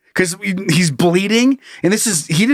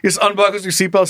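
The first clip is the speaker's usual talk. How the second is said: usual speaking voice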